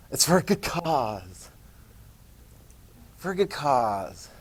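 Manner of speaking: slow, low, softened plea; mock earnest appeal. Recruiter excusing unpaid war service, slow mock-earnest appeal.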